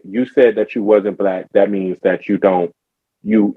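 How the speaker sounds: ordinary talk, nothing marked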